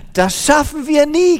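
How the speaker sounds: high, loud despairing complaint; lamenting. A narrator's reading. Tired woman complains to her helper in despair. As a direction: cry out that it is hopeless. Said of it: "klagte die Frau".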